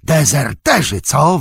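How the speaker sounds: high, menacing orc growl; snarled accusation. A narrator's reading. Orc driver accusing two supposed deserters, snarling. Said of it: "warknął".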